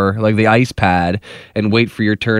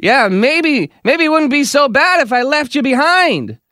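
high mock sarcastic wife; wife's jab; 13 semitones higher than usual. Bickering wife jabbing at husband, high mock sarcasm. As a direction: tease him sharply in a high put-on voice. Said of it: "the wife says".

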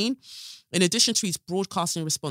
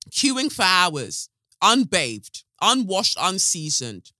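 slow scornful drag; 2 semitones higher, 5 dB louder, slower than usual. Acted. Stretched scornful emphasis.

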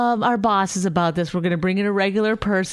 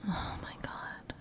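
exasperated whisper; 20 dB quieter than usual. Real, not acted. Exasperated under breath.

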